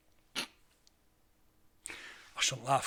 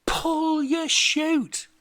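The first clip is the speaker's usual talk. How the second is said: slow, high, loud drawn-out yell; long hammed-up shout skyward; 13 semitones higher, 8 dB louder, much slower than usual. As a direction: yell it up at a falling pig. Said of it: "he shouts up to the pig".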